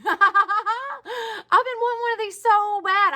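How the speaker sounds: real joyful laughter, close to tears; laughing, teary joy. Real, not acted. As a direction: laugh and half-cry with joy. Overjoyed at long-sought calendar.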